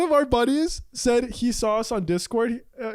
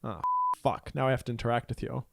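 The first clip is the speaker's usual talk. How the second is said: muttered aside; 9 semitones lower, 9 dB quieter than usual. Retold or imitated. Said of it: "I was like".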